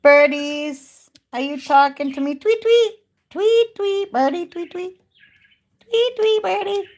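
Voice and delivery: high, loud birdy chirping voice. Real, not acted. Talking to her parakeets in high cooing baby talk. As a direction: coo and tweet up high at the birds. Talks to her pet birds.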